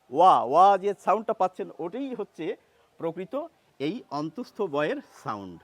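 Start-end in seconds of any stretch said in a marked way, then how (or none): none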